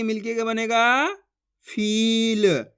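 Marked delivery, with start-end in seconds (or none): 1.7-2.6 फील with a long stretched ee; drawn-out vowel. Shown as a demonstration. Teacher shows the joined word, फील drawn out long. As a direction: hold the ई in फील, then the ल. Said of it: "इसी तरीके से पढ़ना".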